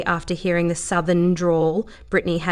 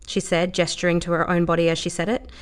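usual speaking voice

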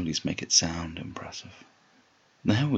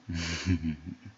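rolling closed-mouth chuckle; amused chuckle. Acted. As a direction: let out a teasing little laugh. Amused laugh during roleplay.

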